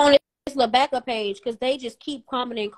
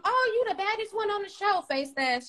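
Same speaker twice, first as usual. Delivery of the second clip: mock quote; 7 semitones higher, 7 dB quieter than usual. Mimicking people messaging in her DMs.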